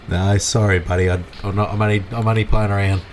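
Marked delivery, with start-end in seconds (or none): none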